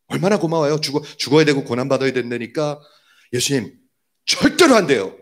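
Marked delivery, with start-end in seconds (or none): 3.3-5.2 scolding refusal. Retold or imitated. Peter telling Jesus he must not die, scolding refusal. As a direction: object firmly, voice leaping up. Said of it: "이렇게 야단을 쳐요".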